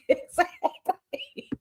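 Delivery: breathy, voiceless laugh through the word; drawn out and lost in laughter. Real, not acted. Agreeing with a viewer's bald eagle joke, laughing.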